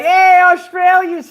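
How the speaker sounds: high, slow, squealing "Yay"; high-pitched gleeful welcome; excited cheer. Real, not acted. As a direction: cheer like a delighted fan. Cheering the Australian viewers.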